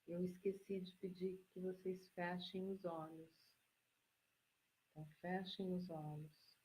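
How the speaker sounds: quiet aside kept inside a meditation; soft meditation voice. Real, not acted. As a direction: gently ask them, keeping the hushed calm. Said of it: "Eu vou ter que mudar meu tom de voz". (voz baixa e calma de meditação)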